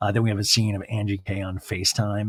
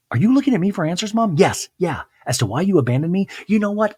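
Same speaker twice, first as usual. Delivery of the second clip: higher, louder and fast; mock heated shouting; 10 semitones higher, 5 dB louder than usual. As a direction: hurl the questions back, rising. Heated restaurant argument.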